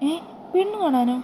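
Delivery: shocked, high-pitched disbelief; a startled voice rising sharply; surprised. Acted. Daughter hears of bride viewing, shocked and high.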